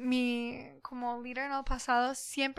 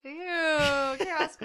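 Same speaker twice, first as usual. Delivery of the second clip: loud grossed-out ew; disgusted ew; 3 semitones higher, 7 dB louder, much slower than usual. Real, not acted. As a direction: recoil in disgust. Reacts to mould image.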